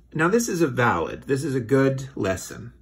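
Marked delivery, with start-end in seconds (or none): none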